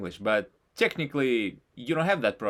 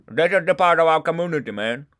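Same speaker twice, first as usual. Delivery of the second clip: put-on Indian accent; 3 semitones higher, 5 dB louder than usual. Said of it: "their heavy accent".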